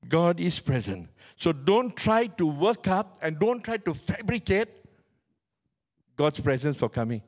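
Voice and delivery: usual speaking voice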